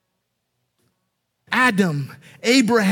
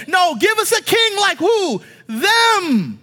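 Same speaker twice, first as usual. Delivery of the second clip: raised, insistent voice of a crowd; acted demand; 8 semitones higher, 3 dB louder than usual. Israel demanding a king from God, insistent. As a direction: play a people refusing and demanding.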